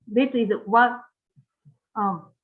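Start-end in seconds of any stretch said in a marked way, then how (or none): none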